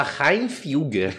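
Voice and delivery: "Reinfilger" aportuguesado, "rain-fíu-guer"; pronúncia errada. Retold or imitated. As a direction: erre Hilfiger dizendo "Reinfilger", como se fosse alemão. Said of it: "não vai saber pronunciar o Hilfiger". ("Reinfilger" exagerado, com "rain" no começo)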